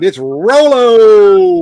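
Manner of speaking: drawn-out loud welcome; excited greeting. Real, not acted. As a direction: stretch the name out warmly. Greeting arriving viewer.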